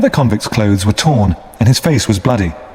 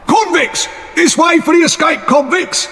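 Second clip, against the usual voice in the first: a much higher shout calling the soldiers. A narrator's reading. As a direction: yell it out to be found. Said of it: "shouted the other".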